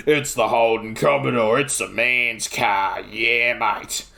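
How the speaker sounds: low, drawn-out blokey commercial voice; deep, gravelly ad voice. Retold or imitated. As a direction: mimic a gravelly Aussie car advert. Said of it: "Holden was doing the exact same thing".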